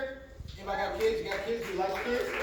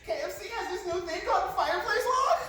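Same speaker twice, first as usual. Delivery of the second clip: put-on high, loud, overjoyed girlfriend voice; high-pitched excited woman showing off a gift; gushing wife; 13 semitones higher, 5 dB louder than usual. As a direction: squeal the surprise like a thrilled girlfriend. Wife's delighted reveal voice.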